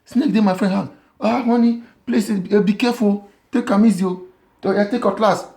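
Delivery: high, pleading wife's voice; a high anxious plea for him to be careful; worried wife. Retold or imitated. Wife begging her husband to take care, high and anxious. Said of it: "Na him the woman say".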